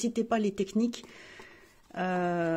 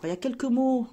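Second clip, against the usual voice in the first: normal talking voice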